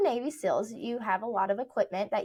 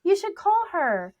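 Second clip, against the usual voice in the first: excited friend referral; 10 semitones higher, 5 dB louder than usual. Retold or imitated. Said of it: "getting thrown out".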